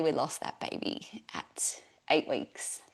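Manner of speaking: near-whispered, slow and soft; barely voicing the loss, real sorrow; quiet grief. Real, not acted. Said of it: "I'm still getting emotional about this".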